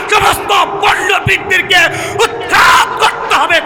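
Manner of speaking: high, loud fiery sermon shouting; preaching at full force. Acted. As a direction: thunder at the crowd against the frauds. Fiery preaching against frauds. (উচ্চস্বরে জ্বালাময়ী ওয়াজ)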